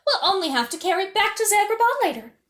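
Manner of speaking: haughty. Acted. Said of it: "Faiz announced haughtily".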